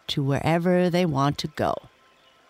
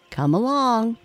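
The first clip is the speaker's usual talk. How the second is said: raised, higher-pitched call; 7 semitones higher, 3 dB louder than usual. A narrator's reading. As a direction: call the children to follow. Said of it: "called their father".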